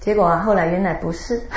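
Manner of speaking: usual speaking voice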